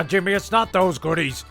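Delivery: normal talking voice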